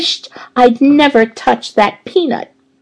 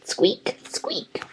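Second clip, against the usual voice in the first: breathless whispered squeaks; hamster squeaks; 14 dB quieter than usual. A narrator's reading. Humphrey squeaking at the janitor, whispered hamster squeaks. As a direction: squeak it out like a tiny frightened hamster. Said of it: "it only came out as squeak".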